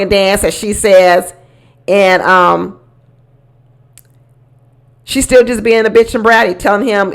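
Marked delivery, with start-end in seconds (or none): none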